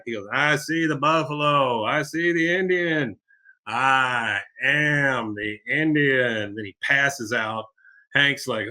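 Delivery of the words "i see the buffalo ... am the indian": hammed-up trance, slowing right down at the end; drawn-out mock-mystical proclamation; delirious vision. Delirious vision before passing out.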